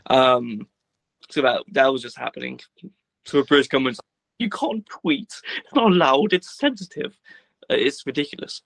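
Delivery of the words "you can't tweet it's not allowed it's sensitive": mocking prissy official. Mocking the government's line.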